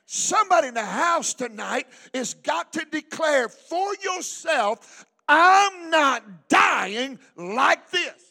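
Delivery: loud, drawn-out revival preaching; full-force preacher's shout; fervent preaching. Real, not acted. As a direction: hammer each declaration out to the congregation.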